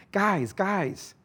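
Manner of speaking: high-pitched mild yell. A narrator's reading. Jerry yells to stop the scuffle, pitched high. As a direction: break up the fight with a high call. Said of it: "Jerry yelled".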